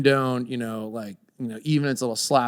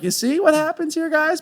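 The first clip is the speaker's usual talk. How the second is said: mock lecture; 4 dB louder than usual. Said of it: "I'm like".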